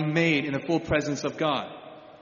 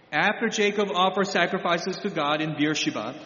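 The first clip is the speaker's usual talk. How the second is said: usual speaking voice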